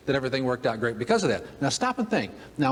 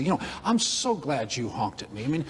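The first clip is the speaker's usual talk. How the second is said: mock gracious rambling; 6 semitones higher than usual. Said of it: "I go back and I say".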